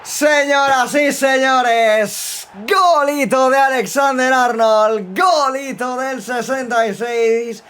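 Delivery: slow, high, loud broadcaster voice; goal commentary. Acted. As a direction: stretch it out like a TV commentator.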